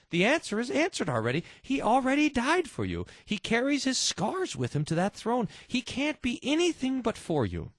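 rising preaching. Real, not acted. Preacher rises to climax.

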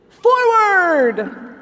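loud, high rallying cry; proclaimed motto. Pretend. Host reading the state motto, loud and rousing. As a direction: belt it out like a battle call.

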